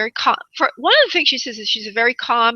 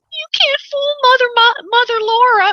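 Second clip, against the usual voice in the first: much higher, louder singsong boast; playful teasing; 7 dB louder than usual. Real, not acted. Psychic repeating her catchphrase to viewers, playful higher singsong. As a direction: crow the catchphrase with mock pride.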